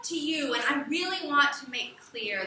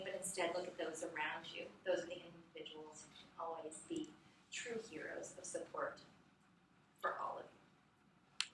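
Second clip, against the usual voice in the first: voice winding down; 5 semitones lower, 12 dB quieter than usual. Shown as a demonstration. Teacher modelling a speech's ending to students, voice going down. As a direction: voice sinking and fading to the close. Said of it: "how our voice goes down".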